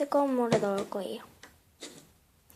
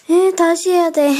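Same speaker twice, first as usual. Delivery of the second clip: dismayed; 6 semitones higher, 12 dB louder than usual. Real, not acted. The mold slipped out.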